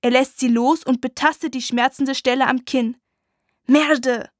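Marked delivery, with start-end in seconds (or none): 3.7-4.3 exasperated curse. Acted. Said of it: "flucht er entnervt".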